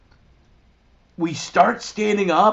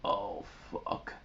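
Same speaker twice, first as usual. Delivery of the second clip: whispered dread; 12 semitones lower, 20 dB quieter than usual. Retold or imitated. Friends reacting in shock.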